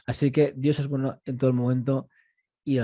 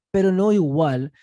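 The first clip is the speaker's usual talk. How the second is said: "pero no igual" punched higher and louder, slowed down; emphatic contrast; 4 semitones higher, 8 dB louder, slower than usual. Real, not acted. Teacher stressing to the class that Jesus was not the same, emphatic. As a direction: lean hard on "no igual" to drive the point home.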